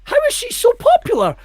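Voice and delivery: high-pitched comic outrage; worked-up, squeaking indignation; exasperated rant. Real, not acted. Mock rant about Peppa Pig.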